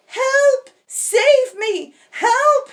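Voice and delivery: very high, loud cry for help. Acted. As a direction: scream for help from the treetop. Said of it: "he cried".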